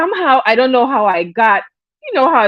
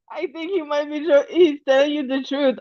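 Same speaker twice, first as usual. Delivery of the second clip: laughing; 4 semitones higher, 5 dB quieter than usual. Real, not acted. Laughing softly.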